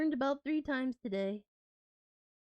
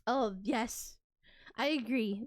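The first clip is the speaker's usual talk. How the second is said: ordinary talk, nothing marked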